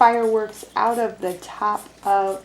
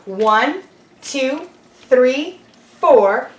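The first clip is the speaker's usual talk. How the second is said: counting aloud; 3 semitones higher, 5 dB louder, much slower than usual. Shown as a demonstration. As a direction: count out loud for the kids. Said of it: "I wanna hear lots of counting".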